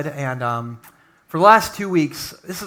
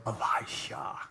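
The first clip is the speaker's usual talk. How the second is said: whispered reveal; 7 semitones lower, 15 dB quieter, slower than usual. Acted. Dramatic reveal of successor.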